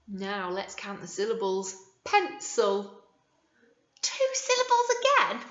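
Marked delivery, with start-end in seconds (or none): 4.0-5.4 sing-song question. Acted. Teacher quizzing young children.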